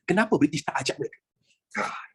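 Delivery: ordinary delivery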